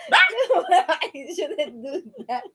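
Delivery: helpless real giggles, high; laughing hard. Real, not acted. Too amused by the teasing to explain, laughing hard. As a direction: struggle to talk through a laughing fit. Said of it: "turning red already for laughing so hard".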